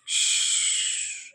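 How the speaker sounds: long unvoiced shush. Real, not acted. Woman hushing the cat hunting mice, long quiet shh.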